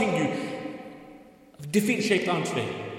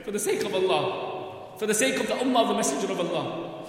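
usual speaking voice

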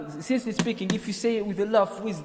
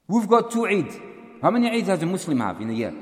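normal talking voice

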